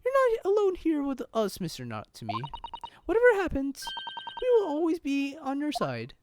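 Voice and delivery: high, gentle character voice; put-on high voice of a devoted helper; loyal assistant. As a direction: reassure the client in a high voice.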